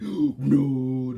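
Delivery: sudden loud shocked no after a gasp. Retold or imitated. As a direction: blurt it out, floored. Gasp then shocked no at art.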